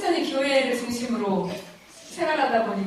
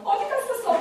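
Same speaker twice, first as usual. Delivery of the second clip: put-on high, reproachful child's cry; a child scolding the mother who came back; 12 semitones higher, 3 dB louder than usual. Lost child reproaching the returned mother, high and hurt. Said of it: "막 이런 것처럼 그렇게 운 것 같아".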